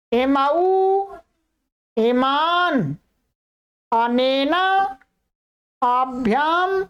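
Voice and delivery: slow, clear Sanskrit declension chant, each form set apart; careful recitation. Shown as a demonstration. Teacher demonstrating forms for students to hear, slow recitation. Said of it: "पहले सुनिएगा".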